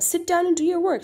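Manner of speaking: put-on mother ordering her child to sit down; stern command. Shown as a demonstration. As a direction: snap it at the child like a cross mother. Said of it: "the mother is saying it sternly".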